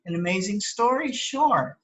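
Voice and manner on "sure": ordinary talk, nothing marked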